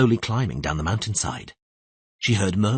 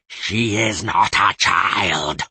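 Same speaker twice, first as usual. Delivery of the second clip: cold mocking voice; 3 dB louder, much slower than usual. The possessing voice mocking Tris, cold and hostile. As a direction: threaten her with cruel scorn.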